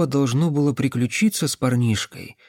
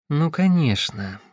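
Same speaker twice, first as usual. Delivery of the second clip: ordinary delivery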